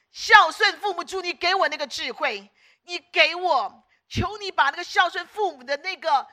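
high, loud insistent plea; fervent pleading prayer. Shown as a demonstration. Preacher modelling prayer for filial wisdom, fervently pleading. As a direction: cry out to God, demanding it earnestly. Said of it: "上帝很喜欢这种祷告".

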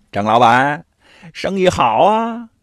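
oily mock greeting, endings stretched; snide, insinuating. A narrator's reading. Rival shop owner greets the goose seller, snide. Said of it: "说话有点阴阳怪气儿的".

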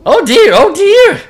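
high, loud worried wail; fretful cry. Acted. Gabu despairs over the dusty kingdom, high anxious cry. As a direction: wring your hands over the mess. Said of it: "cried Gabu".

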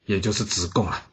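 ordinary delivery

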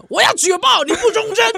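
mock Beijing accent, high and loud. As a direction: play up a loud Beijing informer. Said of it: "北京腔马上出来". (北京腔)

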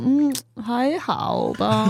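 slow, drawn-out lukewarm verdict; feigned reluctance. Shown as a demonstration. Diner angling for free food answers the waiter, slow and noncommittal. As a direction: hold back approval, dragging out the words. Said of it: "你要说".

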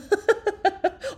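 a high, rising burst of laughter. Real, not acted. Telling a friend the story, she bursts into high laughter.

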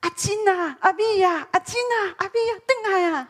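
a mother shouting names down the street at dusk; mothers calling kids home. Retold or imitated. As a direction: call the children home at the top of your voice. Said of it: "所以我们就听见我妈妈的声音啊".

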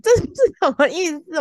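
wife baffled by husband's cryptic words, whining; whiny confusion. Retold or imitated. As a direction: whine as if near tears from confusion. Retelling her confusion.

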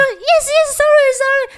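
high, fast apologetic yes sorry; flustered apology. Retold or imitated. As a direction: apologise in a hurry, sheepish. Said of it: "然后我们就". (慌忙道歉)

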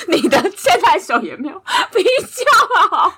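high, slow, laughing through words. Real, not acted. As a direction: tease while cracking up.

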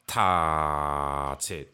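塔切 slow and drawn out, nothing cut short; 塔切 held long with no closing stop; stretched syllables. Shown as a demonstration. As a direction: drag 塔切 out. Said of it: "就可以拉很长".

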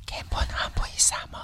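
hissing Voldemort-like whisper. Retold or imitated. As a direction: whisper it like a dark wizard. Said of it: "好像哈利波特的伏地魔".

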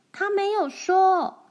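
high, cheeky retort; little girl talks back to her sister, high and cheeky. A narrator's reading. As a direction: talk back to your sister. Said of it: "他反驳着".

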